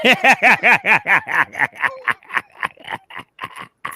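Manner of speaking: long, loud burst of high laughter. Real, not acted. Host cracking up at his own remark, loud laughter. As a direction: crack up loudly, laugh trailing on.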